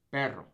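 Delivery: "Perro" with a trilled rr; rolled R. Shown as a demonstration. Teacher drills double R, rolled R. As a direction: roll the rr in "Perro". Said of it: "You really gotta roll those R's".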